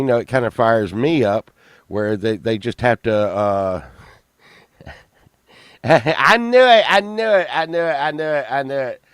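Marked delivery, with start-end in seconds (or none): 5.8-9.0 high burst after a laugh, easing down with each repeat; gleeful exclaiming. Real, not acted. Host seeing the reaction coming, gleeful exclaiming. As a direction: burst out gleefully, then let it run down.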